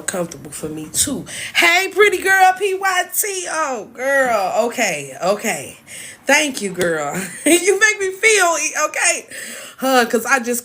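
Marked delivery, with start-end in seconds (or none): 1.5-4.7 calling out to a friend with delight; excited greeting. Real, not acted. Greeting her friend, high and excited. 7.4-9.3 emotional laugh. Real, not acted. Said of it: "I'm so emotional right now".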